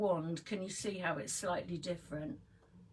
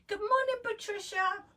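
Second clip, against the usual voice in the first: high sing-song greeting; 14 semitones higher than usual. Real, not acted. Greeting someone mid-tutorial.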